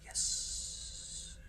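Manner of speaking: whispered yes, long hissing S; Kip. Retold or imitated. Hobbyist celebrating like a film character, hissed yes. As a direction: draw out a soft, hissy yes. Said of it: "do it like Napoleon Dynamite does it".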